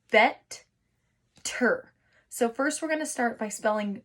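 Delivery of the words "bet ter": Bet-ter whispered in two beats; syllables split. Shown as a demonstration. Teacher to kids, whispered syllable split. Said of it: "better is two syllables".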